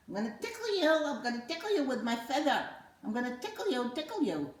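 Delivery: very high, fast teasing feather voice; a silly feather creature about to tickle; high playful puppet voice. Acted. Feather puppet teasing the child, high and fast.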